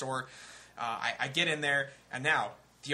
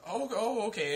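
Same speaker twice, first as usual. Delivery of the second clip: put-on old cashier voice; 8 semitones higher than usual.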